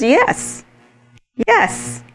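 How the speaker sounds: high, eager yes, yes. Retold or imitated. Woman answering the Lord, high eager yes. Woman admits it still hurts.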